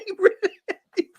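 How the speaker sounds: very high, through laughter; laughing insistence. Real, not acted. Teasing about Billy Gunn to co-host, laughing insistence. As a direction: squeal it while laughing.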